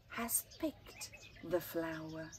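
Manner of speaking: usual speaking voice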